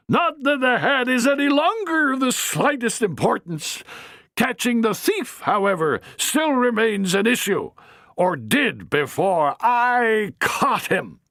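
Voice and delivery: high grand dame voice; a haughty older lady, pleased with herself; haughty matron. Acted. Matron lofty.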